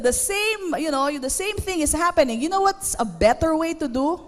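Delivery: normal talking voice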